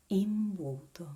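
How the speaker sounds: imbuto loud and careful, "eem-BOO-to"; pronunciation model. Shown as a demonstration. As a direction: model a difficult imbuto loudly for learners. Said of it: "It's a difficult word".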